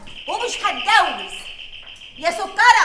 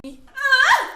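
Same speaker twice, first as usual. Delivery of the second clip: high, loud yells to get out; panicked shouting; 7 semitones higher, 4 dB louder than usual. Acted. Woman yells at intruders to get out, panicked loud shouting. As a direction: shoo them out at the top of your voice. Shooing strangers out of room.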